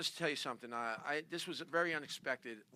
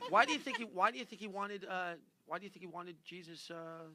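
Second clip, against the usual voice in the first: ordinary talk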